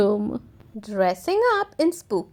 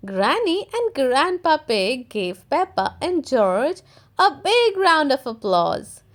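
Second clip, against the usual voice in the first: usual speaking voice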